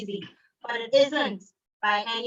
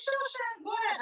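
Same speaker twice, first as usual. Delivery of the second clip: his easy yes in a much higher, softer voice; 1 high, soft quote; 13 semitones higher, 7 dB quieter than usual. Retold or imitated. The speaker quoting his reply, high and soft. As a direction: toss off the permission lightly and high. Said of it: "He's like".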